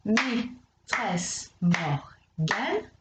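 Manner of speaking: "maîtresse Morgane" very slow, each syllable separate; syllables spelled out. Shown as a demonstration. Teacher showing a pupil the syllables, very slow. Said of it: "j'ai dit quatre syllabes différentes".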